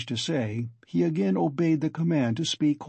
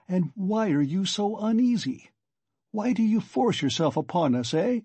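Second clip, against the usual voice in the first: higher, lilting teasing questions; good-humored, sly; 6 semitones higher than usual. A narrator's reading. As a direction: needle him with playful questions. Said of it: "perfectly good-humored, sly, and composed face".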